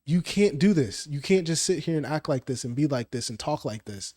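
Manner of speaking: ordinary talk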